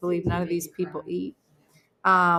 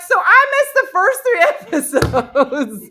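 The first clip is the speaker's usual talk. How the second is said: laughing disbelief; 13 semitones higher, 8 dB louder than usual. Retold or imitated. Retold realising she missed acts.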